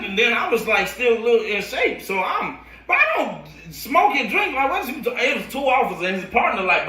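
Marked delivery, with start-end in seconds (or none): 2.9-5.1 indignant. Retold or imitated. Retold protest to police.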